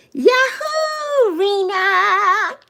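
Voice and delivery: high, whooping yahoo; excited cheer. Real, not acted. Streamer hails Rena in chat, excited whoop.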